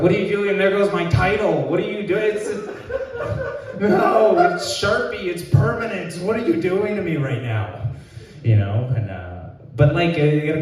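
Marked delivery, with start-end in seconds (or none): 0.0-7.9 high, pleading whine; distressed whine. Retold or imitated. Brother whines high at the boyfriend over the Sharpie mark. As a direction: whine like your wall is ruined. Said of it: "And I was like".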